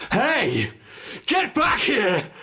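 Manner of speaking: high, loud shout, slowing on the last words; furious shout. Acted. Villain shouting after the escaping human. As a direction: bellow after the fleeing prey.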